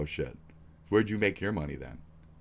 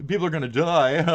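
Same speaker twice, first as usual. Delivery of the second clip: sarcastic; 6 semitones higher, 4 dB louder than usual. Acted. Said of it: "Uh, sarcasm".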